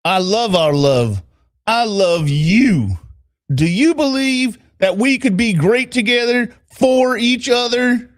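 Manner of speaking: slow Southern declaration, rising into the question; Southern accent. Acted. Boyfriend declares his love to her, slow Southern accent. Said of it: "trying to do a Southern accent".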